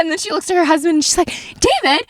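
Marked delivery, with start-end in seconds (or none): 1.3-2.0 high-pitched urgent questioning; breathless-with-news mock urgency; imitated excited aunt. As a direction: mimic aunt pressing her husband to get it. Aunt urging her husband.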